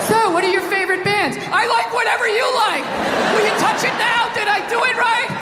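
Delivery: hammed-up awkward teen on a date; mock nervous teen. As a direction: play a desperate sixteen-year-old.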